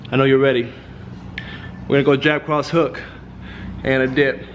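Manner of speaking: low firm coach voice; steady, commanding trainer; boxing coach calling combos. Acted.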